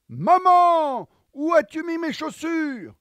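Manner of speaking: put-on high, loud child calling mom; little girl calling. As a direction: call out like a worried little girl. Juliette calls mother.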